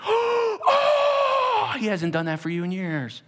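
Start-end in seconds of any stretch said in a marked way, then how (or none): 0.0-1.7 big gasp, then high drawn-out "oh"; hammed-up squeal of delighted surprise; excited gasp and squeal. Husband spotting his college buddy, squealing with excitement. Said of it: "he sees his buddy come from college and he's like".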